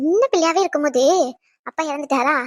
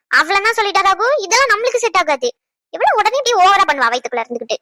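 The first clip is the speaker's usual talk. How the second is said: ordinary talk, nothing marked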